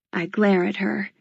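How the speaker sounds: ordinary delivery, nothing marked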